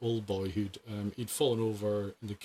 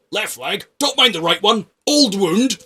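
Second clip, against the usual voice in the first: put-on higher, louder, booming old veteran; booming old soldier; 10 semitones higher, 13 dB louder than usual. Old veteran telling the paramedic about his leg, booming. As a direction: boom it out with gusto. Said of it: "this big booming voice".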